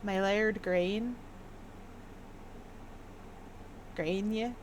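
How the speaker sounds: put-on tentative, slow My laird grain with old vowels; old English pronunciation. Shown as a demonstration. Puzzling over an old scroll, testing old-timey pronunciation. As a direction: try out pre-vowel-shift sounds, unsure. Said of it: "how does that go?".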